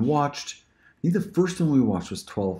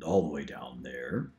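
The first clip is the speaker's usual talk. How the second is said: "all the way down there" dropped much lower and softer; 9 semitones lower, 8 dB quieter than usual. Shown as a demonstration. Demo of range: "all the way down there" down low. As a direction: sink to the bottom on "all the way down there". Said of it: "do a few more directions of the voices".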